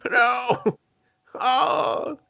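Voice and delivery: high crying shout; hammed-up high wail; mock cry. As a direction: wail it like the old man. Echoes the old dude's cry.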